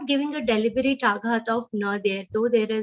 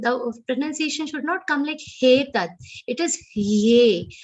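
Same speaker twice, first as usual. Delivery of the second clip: ordinary talk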